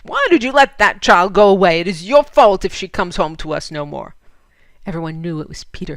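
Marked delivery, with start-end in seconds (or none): 0.0-4.1 loud furious blame; angry roar. Acted. Peter blames his wife for sending Lena, furious. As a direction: lay the fault on her. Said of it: "he roared at his wife".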